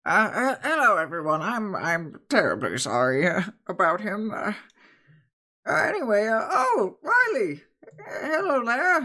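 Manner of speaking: lightly voiced, stammering old woman; flustered old woman. Old woman apologising to the group, lightly voiced and flustered. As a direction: fumble your words like a flustered granny. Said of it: "The older woman goes over and goes".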